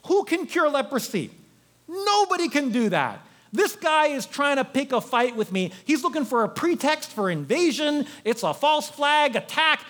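high, agitated outburst; ranting king. Acted. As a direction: act out a king losing it. King ranting about the letter.

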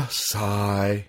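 slow, drawn-out sighing punchline; dejected sigh. Acted. The Born Loser answers what he would be, dejected.

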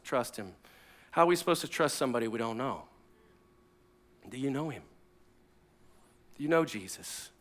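soft, low, searching questions; quiet pastoral challenge; hushed, earnest appeal. Real, not acted. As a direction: ask it gently, with pauses to let it land. Preacher asks congregation a searching question.

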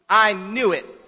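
slow, loud sneer. A narrator's reading. Defiant boy to the principal on hearing of punishment, sneering. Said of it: "sneers the boy".

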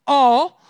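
the word All, loud and final; punchline stress. Real, not acted. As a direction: land All hard as the punchline. Punchline of Greek lookup.

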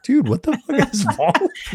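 imitating his wife's outburst through laughter; laughing wife's outburst. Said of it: "And she's like".

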